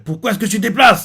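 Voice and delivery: high, loud scolding mother. Retold or imitated. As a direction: tell the kid off sharply. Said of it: "Sa mère l'engueule".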